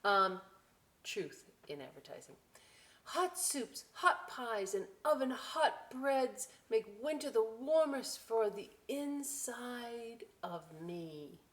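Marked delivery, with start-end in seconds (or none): 3.0-11.4 put-on slow, lively read-aloud to children; storybook voice, slow and bright; story reading. A narrator's reading. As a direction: savour each line for the kids. Reading book.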